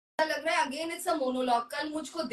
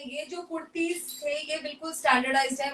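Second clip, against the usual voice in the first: ordinary talk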